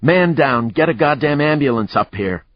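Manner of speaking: high, loud urgent call; urgent shout. Acted. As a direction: bark it into the radio. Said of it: "Lucas put the radio back up and shouted".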